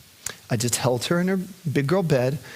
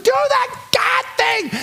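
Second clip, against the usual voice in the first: put-on child's demand; slower than usual. Daughter demanding father's prayer, high slow shout. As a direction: insist like a determined toddler.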